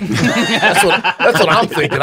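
loud laughing agreement; real laughter while agreeing. Real, not acted.

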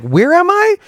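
high, leaping questioning pitch; lost brain. Acted. Comic voicing their brain losing the joke mid-set, high-pitched confusion. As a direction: voice shoots way up, mock bewildered. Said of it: "'Cause your brain's like".

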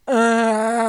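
loud grunting moan, imitating husband; exaggerated moaning grunt; mock full-blown moan. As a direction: groan it out like a loud bathroom moaner. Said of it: "Bro, moaning, full blown".

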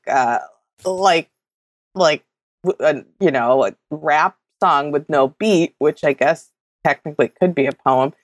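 ordinary delivery

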